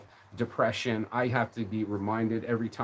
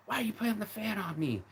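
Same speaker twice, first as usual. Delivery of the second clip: mocking girlfriend voice; 8 semitones higher, 5 dB quieter than usual. Imitating girlfriend's complaint.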